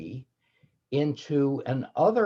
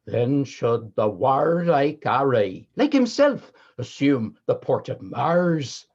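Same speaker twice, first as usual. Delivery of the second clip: grand Elizabethan Original Pronunciation chorus speech; Original Pronunciation accent; 4 semitones higher, 4 dB louder than usual. Shown as a demonstration. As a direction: declaim Shakespeare in Original Pronunciation. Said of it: "by doing the first half of the first chorus, uh, from Henry the Fifth in O P".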